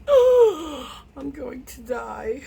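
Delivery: high wail sinking into slow doom; mock scared. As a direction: whimper in hammed-up dread. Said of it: "Be all scared and everything".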